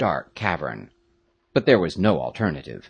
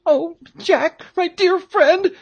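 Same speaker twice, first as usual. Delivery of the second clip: put-on very high, loud, fearful boy; high, desperate character voice; pleading Peterkin cry; 5 dB louder, slower than usual. A narrator's reading. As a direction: cry out to a friend in high-pitched distress. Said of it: "cried Peterkin, turning pale".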